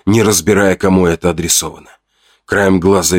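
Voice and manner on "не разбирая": plain reading voice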